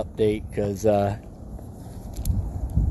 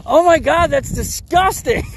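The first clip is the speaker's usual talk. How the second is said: disgusted woman; 13 semitones higher than usual. Retold or imitated.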